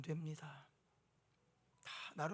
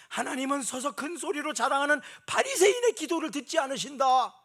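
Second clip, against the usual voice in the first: much higher, louder proclamation; preaching at full force; 9 semitones higher, 13 dB louder than usual. Real, not acted. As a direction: thunder it from the pulpit.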